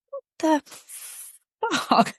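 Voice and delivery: slow, drawn-out stunned what the fuck; stunned disbelief. Retold or imitated. Woman reacting to her partner's breakfast offer, slow stunned disbelief. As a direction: let it hang in slow disbelief. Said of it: "And I'm like".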